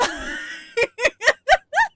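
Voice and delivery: loud, high burst of laughing; shrieking laugh; real laughter. Real, not acted. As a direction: crack up in high, loud peals. Cracks up after yelling.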